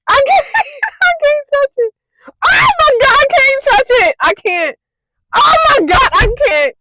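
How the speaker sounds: high, loud tearful yelling; frantic near-sobbing shouts; panicked crying. Real, not acted. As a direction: cry out, half laughing in panic. Panicking touching the hair.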